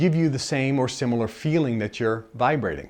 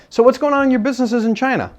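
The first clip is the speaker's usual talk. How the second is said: higher, quicker breezy question; quick upbeat quip; casual question; 10 semitones higher, 5 dB louder, faster than usual. Retold or imitated. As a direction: toss the question lightly. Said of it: "And then I go".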